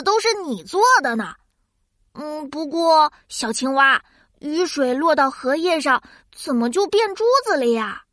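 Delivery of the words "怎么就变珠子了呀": ordinary delivery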